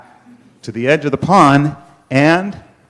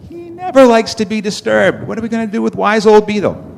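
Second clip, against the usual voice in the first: high-pitched worried animal voice; fretful protest; 8 semitones higher than usual. Acted. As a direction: fret aloud in a raised pitch. Animals fret about consulting Beetle.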